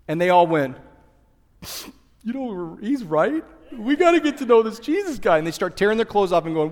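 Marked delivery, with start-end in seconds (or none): 2.2-5.4 crowd voice. Pretend.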